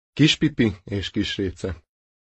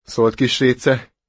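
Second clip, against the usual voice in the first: usual speaking voice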